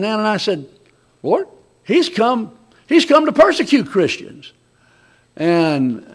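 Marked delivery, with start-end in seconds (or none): 1.2-4.5 alarmed protest. Acted. Ananias protesting to God.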